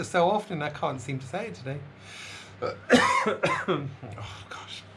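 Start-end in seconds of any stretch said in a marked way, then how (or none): 2.6-3.6 cough. Real, not acted. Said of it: "I've not got enough breath".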